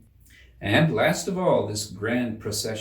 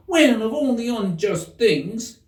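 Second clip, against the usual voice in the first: much higher, louder and slow outraged protest; high, slow, indignant card gardener; 12 semitones higher, 5 dB louder, slower than usual. Acted. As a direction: rise high and drag it out in indignation. Seven protests.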